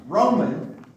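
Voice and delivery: "Roman" said firmly as the correction; corrected word stressed. Real, not acted. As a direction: set it right, firmly. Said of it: "not Russian".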